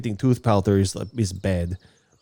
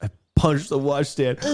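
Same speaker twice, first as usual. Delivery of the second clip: ordinary talk, nothing marked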